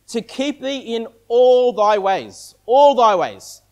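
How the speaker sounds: slow verse with "all" drawn out; stress on ALL. Real, not acted. Preacher answering what was missing, slowly stressing "all". Stressing the words Satan omitted.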